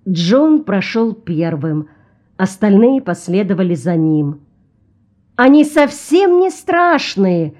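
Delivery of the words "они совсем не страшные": high, slow female onlooker; woman's voice. A narrator's reading. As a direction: exclaim in a raised feminine pitch. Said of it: "донёсся до него женский голос".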